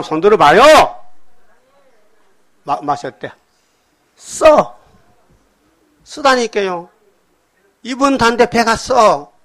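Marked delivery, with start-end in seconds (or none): 4.2-9.3 bitter complaint. Pretend. Answering how the devil tastes.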